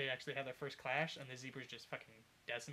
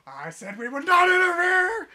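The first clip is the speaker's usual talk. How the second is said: high, very loud, drawn-out scream; furious acted scream; 19 dB louder, slower than usual. Ruler outraged her order was broken, screaming furiously. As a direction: roar it slowly, climbing into a scream. Enraged ruler after reading the notice.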